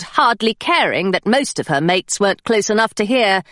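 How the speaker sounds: ordinary delivery, nothing marked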